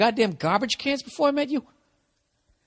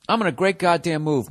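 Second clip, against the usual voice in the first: ordinary talk, nothing marked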